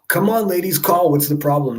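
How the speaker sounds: loud, high urging call; rally the viewers to call in. Real, not acted.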